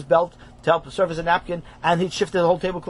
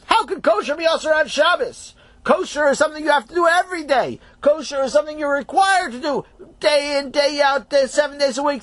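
higher, insistent lecturing rabbi; indignant Rav; 8 semitones higher than usual. Acted. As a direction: scold with disbelief.

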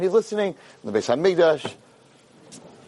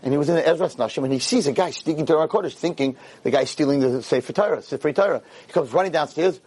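normal talking voice

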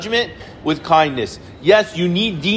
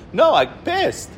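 daughter's reply; 7 semitones higher, slower than usual. Retold or imitated. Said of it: "She goes".

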